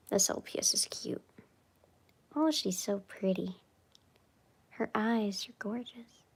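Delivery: soft admiring whisper. Real, not acted. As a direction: whisper it to yourself. Whispering over pictures.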